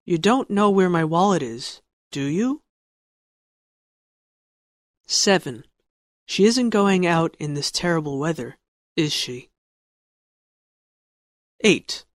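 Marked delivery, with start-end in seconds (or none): 2.1-2.6 "do you?" rises sharply at the end; rising tune on "do you?"; rising tag question. Shown as a demonstration. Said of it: "falling intonation or rising intonation". 8.9-9.5 "is she?" falls low at the end; falling tune on "is she?"; falling tag question. Shown as a demonstration. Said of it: "falling intonation or rising intonation".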